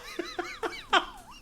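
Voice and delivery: very high, squeaky burst of laughter; squealing laugh. Real, not acted. Streamer squealing with laughter at the scene.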